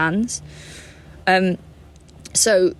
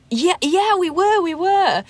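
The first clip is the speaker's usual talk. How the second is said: much higher, eager agreement; playing along; 12 semitones higher than usual. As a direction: jump in fast to go along with the ruse. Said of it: "And she was like".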